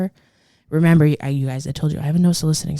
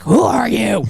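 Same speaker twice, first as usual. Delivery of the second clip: mock homeowner challenge; 8 semitones higher, 6 dB louder, slower than usual. Imagined gruff homeowner reply.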